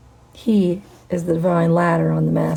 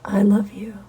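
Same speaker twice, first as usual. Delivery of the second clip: "I love you" whispered, unvoiced and intimate. Real, not acted. Telling viewers she loves them, in a whisper.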